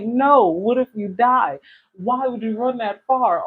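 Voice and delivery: mock panic at a child running off; alarmed scolding. Acting out panic at kids.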